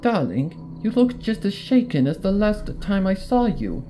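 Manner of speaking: high, theatrical lady voice, last words slow; fussy Rarity. Acted. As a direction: play the dramatic fashionista.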